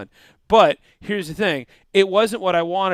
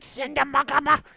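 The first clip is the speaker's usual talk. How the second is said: higher, babbling alien nonsense; Ewok gibberish; 8 semitones higher than usual. Retold or imitated. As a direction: chirp it out like a cheerful little Ewok pilot. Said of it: "And this little Ewok's like".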